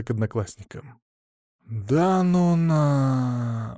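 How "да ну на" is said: hugely drawn-out disbelief; loud dismayed gasp. A narrator's reading. Tikhomir hears the news about changing rooms, drawn-out gasp. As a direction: stretch the last word right out in dismay. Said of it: "очень громко ахнул Тихомир".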